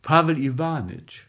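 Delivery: ordinary delivery, nothing marked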